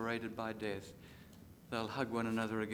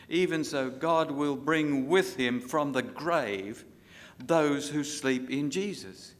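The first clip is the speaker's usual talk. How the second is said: loud, ringing scripture read, slowing at the end; preaching at full force; 2 semitones higher, 6 dB louder than usual. Acted. Preacher reading Thessalonians to the church, loud and ringing. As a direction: proclaim it to the whole congregation.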